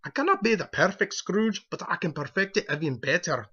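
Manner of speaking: a fan's hammed-up Scottish Scrooge brogue; playful attempt at a Scottish accent; mock Scottish accent. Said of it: "I'll work on my Scottish accent".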